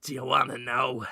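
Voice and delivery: a hint of an aging mentor; old man voice. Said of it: "Willem Dafoe says".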